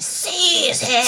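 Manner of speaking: high, hammed-up order to guards; imitating an imperious ruler, high-pitched; mock villain command. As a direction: cry it out like a theatrical tyrant. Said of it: "she's just like".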